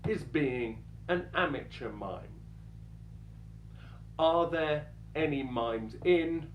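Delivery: ordinary talk, nothing marked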